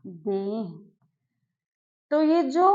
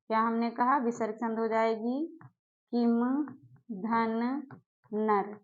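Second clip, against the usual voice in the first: normal talking voice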